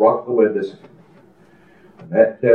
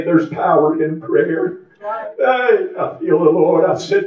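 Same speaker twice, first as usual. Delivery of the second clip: ordinary talk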